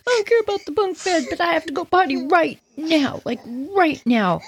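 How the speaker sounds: high, fast and urgent; desperate little girl. Retold or imitated. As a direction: plead like a tiny kid who must pee. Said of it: "I said".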